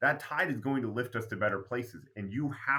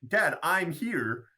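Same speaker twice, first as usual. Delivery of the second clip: retold kid pleading his case, higher; indignant protest; 6 semitones higher than usual. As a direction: argue back at dad, pitched up and aggrieved. Child protesting punishment to his dad.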